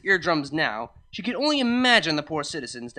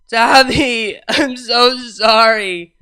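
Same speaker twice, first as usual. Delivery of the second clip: loud, drawn-out wail on so; 6 semitones higher, 11 dB louder, much slower than usual. Acted. Drunk Vinyl sobbing apologies to Tavi, loud wail. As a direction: wail it, stretching so sorry out slowly. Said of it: "Vinyl wailed".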